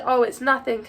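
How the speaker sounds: usual speaking voice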